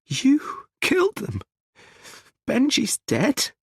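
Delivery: high, slow, shocked disbelief; aghast high voice; horrified mother. Acted. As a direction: recoil in horror as you ask. Mum horrified by confession.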